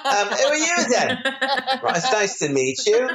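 high, jokey British greeting; British accent. Pretend. Guest pretends to meet soap castmates in a playful British accent. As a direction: put on a bright British accent for a laugh. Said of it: "And I'll be British".

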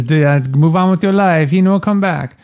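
put-on light Italian mama accent; a hint of the Italian mother. Mama telling Kay to move on, in a light Italian accent. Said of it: "I'm using this accent 'cause Puzo wrote".

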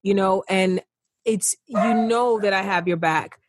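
normal talking voice

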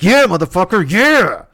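loud, high, fired-up yelling; hyped yell. Retold or imitated. Violent fan cheering the screen, loud and hyped. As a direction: egg the fight on like a rowdy fan. Violent moviegoer eggs on fight.

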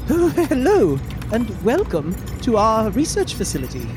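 high, courteous, formal character voice; polite robot host. Acted. Spider-like robot welcomes visitors to research facility, politely formal. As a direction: welcome guests with high-pitched, careful politeness.

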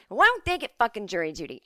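ordinary delivery

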